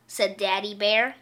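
ordinary delivery, nothing marked

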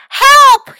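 high, very loud cry for help; yelling for help. A narrator's reading. Mac slips and calls his friends, yelling. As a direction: yell out in alarm. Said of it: "he yelled".

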